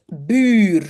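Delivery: long u in "bur"; "bur" loud, with a stretched French u. Shown as a demonstration. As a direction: draw out "bur" like the end of "mercure". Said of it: "c'est comme mercure".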